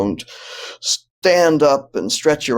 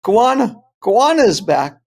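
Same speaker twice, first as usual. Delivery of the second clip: high, loud retold cry of alarm; mock yell, pitched well up; imitated child's yell; 10 semitones higher, 6 dB louder than usual. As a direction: sound the alarm like a daughter yelling. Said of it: "yells again".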